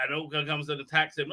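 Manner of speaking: normal talking voice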